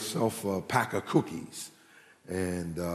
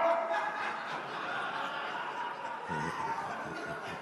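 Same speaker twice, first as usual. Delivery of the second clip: big laughter; 7 semitones lower, 11 dB quieter than usual. Real, not acted. Congregation roars after punchline.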